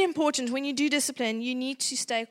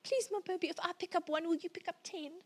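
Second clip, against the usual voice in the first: soft, higher coaxing plea; mock appeasing plea; 4 semitones higher, 9 dB quieter than usual. Mom sliding from pleading to anger, soft coaxing start. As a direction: bargain sweetly with the child.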